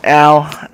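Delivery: sharp, loud yelp of pain; startled pained ow; real pained ow. Real, not acted. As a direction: cry out at a sudden slap. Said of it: "sorry, I slapped myself".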